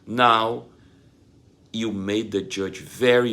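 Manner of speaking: normal talking voice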